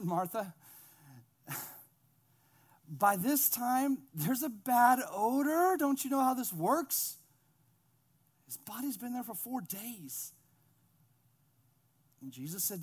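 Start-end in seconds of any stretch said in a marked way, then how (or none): 2.9-10.3 slow, high-pitched acted protest; incredulous objection. Martha warning Jesus about the odor, drawn-out protest.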